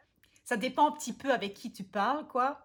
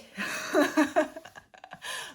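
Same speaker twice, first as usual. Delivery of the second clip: big laugh; 7 semitones higher, 8 dB louder than usual. Real, not acted. Laughs at her thick dialect.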